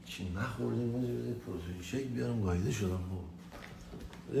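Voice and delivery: barely audible, drained of energy; worn-out mumble. Pretend. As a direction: mumble it like you're completely spent. Exhausted after the long night.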